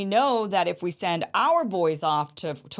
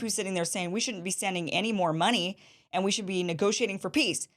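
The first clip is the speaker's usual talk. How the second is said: ordinary delivery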